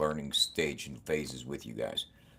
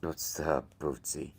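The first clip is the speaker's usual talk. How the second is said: ordinary talk, nothing marked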